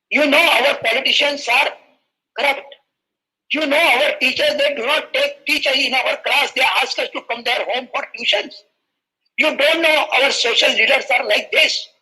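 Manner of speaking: an upset schoolboy venting at the president, pitched high; schoolboy complaining to the president in a high, agitated mock outburst; retold angry outburst. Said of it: "He was irritated".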